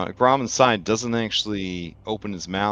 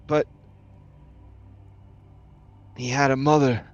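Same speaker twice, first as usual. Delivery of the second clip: higher, halting, strained voice; Grom in the tube, regretful; 4 semitones higher than usual. Acted. Grom admits he failed as a father, regretful. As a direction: confess haltingly with long pauses. Figure in the tank speaks.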